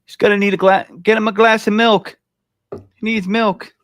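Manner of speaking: high, loud mocking jab. Real, not acted. Mocking a rookie as a boy.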